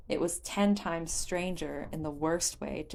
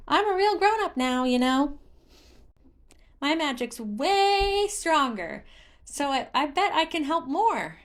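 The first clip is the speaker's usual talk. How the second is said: high, pompous little spirit voice; Hestu trying to sound grown-up; 9 semitones higher, 5 dB louder than usual. A narrator's reading. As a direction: puff up and act important. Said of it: "trying to sound wise and important".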